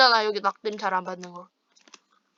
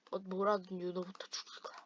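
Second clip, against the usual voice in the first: hushed scheming whisper; 4 semitones lower, 16 dB quieter than usual. Real, not acted. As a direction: whisper the threat under your breath. Teammate scheming to kill Yunho. (숨죽인 속삭임)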